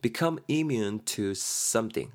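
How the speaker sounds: very slow, each word separate; slow teacher model; slow model phrase. Shown as a demonstration. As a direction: say it slowly for learners to follow. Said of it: "수고에도 적어놨죠".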